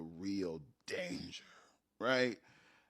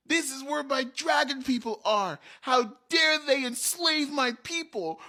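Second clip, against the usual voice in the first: high, loud and outraged; rally against the enslavers; locked in; 13 semitones higher, 8 dB louder than usual. Retold or imitated. Dragon Niece rages at the goblins, loud and outraged. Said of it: "Dragon Niece started to lock in".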